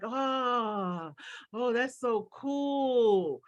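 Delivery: put-on slow, awed child voice gasping at something new; kid's wonder. Coach imitating kids, slow and awed. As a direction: play a wide-eyed kid amazed. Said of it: "they're like".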